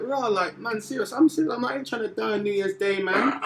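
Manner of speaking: high, loud, pleading panic; mock panic. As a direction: beg like you fear getting stabbed. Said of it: "And I was like".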